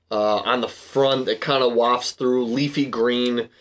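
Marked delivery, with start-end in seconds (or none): none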